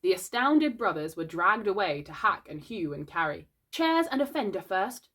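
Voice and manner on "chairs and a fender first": higher-pitched crisp command; mildly bossy instruction; commanding order. A narrator's reading. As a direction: give orders like a boy in charge. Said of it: "Peter ordered".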